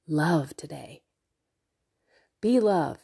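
ordinary talk, nothing marked

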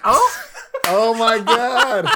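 loud, high, drawn-out laughing exclamation; laughing disbelief. Real, not acted. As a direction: gasp it out through real laughter. Mock epiphany over upset parallel.

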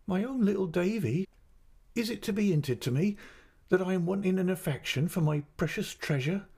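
high, aggrieved, doting mother; crying out. A narrator's reading. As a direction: plead with wounded motherly affection. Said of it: "cried my mother".

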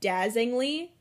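"dazzingly" with the middle L dropped; mispronunciation. Retold or imitated. Teased host quoting her own slip, "dazzingly" missing its L. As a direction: say "dazzingly" as three syllables, no L after the zz. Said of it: "She made fun of me".

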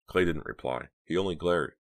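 ordinary delivery

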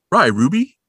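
lightly voiced cartoon dog; Scooby voice. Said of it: "spoke like Scooby".

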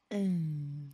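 slow sensual whisper; ASMR whisper. Pretend. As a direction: breathe it close to the mic. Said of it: "I'm like".

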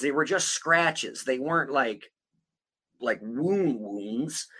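quiet, slow insistence; hushed emphasis. Real, not acted. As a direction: drop it down and drag it. Quietly stressing the point.

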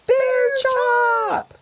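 high, loud, long holler; excited drawn-out call. A narrator's reading. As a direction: holler the name in delight, way up high.

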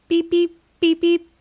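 high, even electronic beeps; phone beeps. A narrator's reading. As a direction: imitate dial tones in two quick pairs. Imitating phone dialling in story.

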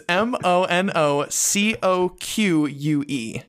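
slow, letter by letter; spelling it out. Shown as a demonstration. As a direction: spell out each letter slowly and clearly. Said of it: "Do you want me to spell that for you?".

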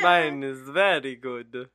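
slow, loud mock Dracula accent. Friend bragging about the accent, slow Dracula accent. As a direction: boast in a hammy Dracula accent. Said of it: "I feel like yours is pretty good".

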